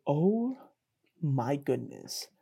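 slow, trailing-off amazement; smitten awe. Retold or imitated. Storyteller reliving first sight of a girl, smitten awe. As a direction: let it fade softly, love-struck.

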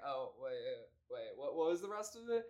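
soft, confused fumbling; lost speechmaker. Acted. Host playing politician who forgot his speech, soft confused fumbling. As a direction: mumble as if you've lost your place. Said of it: "then he's like".

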